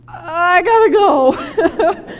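long, drawn-out mock wail; slow, high squeal of comic dread; comic panic. Speaker dodges someone across the room, in mock panic. Acts out escaping an unwanted conversation.

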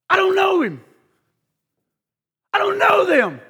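rising frantic no; panicked denial. Acted. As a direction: Peter snapping back in fear. Peter denies him again.

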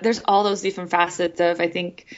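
ordinary delivery

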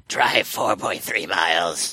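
slow, low creepy navigation voice; creepy monkey GPS voice. Pretend. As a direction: read the directions like a monster GPS. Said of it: "monkey navigation".

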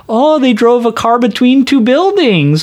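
high-pitched, naively amazed interviewer; imitating Marge interviewing. Marge interviewing Fast and Furious witnesses, innocently amazed. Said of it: "have her interviewing all the people".

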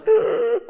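high, loud honking gasp of a laugh; goose-honk laugh. Shown as a demonstration. Imitating a friend's honking laugh, loud and high. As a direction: honk like an old car horn. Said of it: "I did it anyway".

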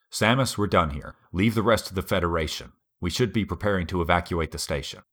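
ordinary talk

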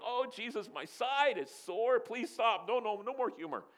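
high, hammed-up begging through laughter; disciples laughing. Disciples to Jesus, begging him to stop joking, hammed-up and high. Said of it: "the disciples are going".

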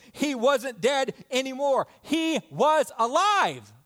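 loud, high triumphant proclamation; exultant preaching. Real, not acted. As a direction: proclaim it at full pulpit force.

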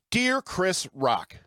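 ordinary talk, nothing marked

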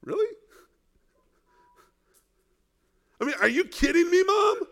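high-pitched disbelief at the mother's request; incredulous. Pretend. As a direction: sound like you can't believe what she just asked. Preacher mocks the mother's request.

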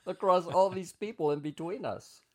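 normal talking voice

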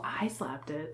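hushed confession; whispering. Real, not acted. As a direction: whisper it sheepishly. Admitting it sheepishly.

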